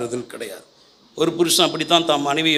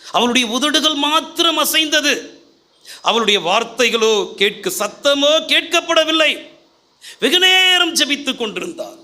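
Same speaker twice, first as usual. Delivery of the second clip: fervent sermon retelling; preaching at full force; 9 semitones higher than usual. Acted. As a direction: drive the story home to the crowd. Preaching the Hannah story.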